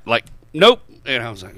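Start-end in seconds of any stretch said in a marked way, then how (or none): 0.5-0.8 the same curt nope again. Retold or imitated. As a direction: shut it down flatly. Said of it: "Like that".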